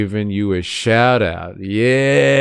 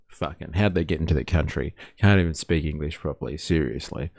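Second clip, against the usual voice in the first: imitating a grumbler; 5 semitones lower, 7 dB quieter, faster than usual. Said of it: "I'd be like".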